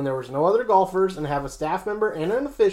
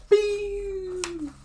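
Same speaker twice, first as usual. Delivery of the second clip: high mock whoosh of a struck golf ball; ball flying sound; 3 dB quieter than usual. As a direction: zip it out like a drive leaving the tee.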